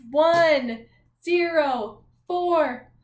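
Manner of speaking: slow, drawn-out numbers, each one stretched; announcing a room number, slow and loud; slow room number call. Shown as a demonstration. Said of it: "when I say your class's room number".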